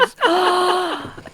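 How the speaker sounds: sharp high excited gasp; delighted gasp. Real, not acted. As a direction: gasp as if a great idea just hit. Sudden delighted idea.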